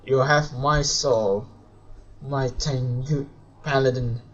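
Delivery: ordinary talk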